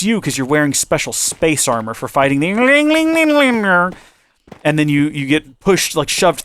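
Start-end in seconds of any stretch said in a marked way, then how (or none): 2.5-3.9 mock echo. Echoing the alien name.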